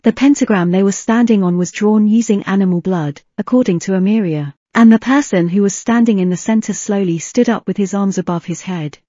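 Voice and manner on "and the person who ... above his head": plain reading voice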